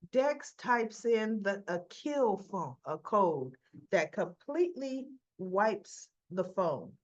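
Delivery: usual speaking voice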